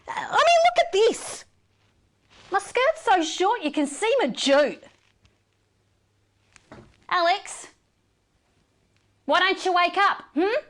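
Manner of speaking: high, loud indignant outburst; furious rant. Acted. News anchor raging at Alex over her costume, high loud fury. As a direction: blast him in outrage.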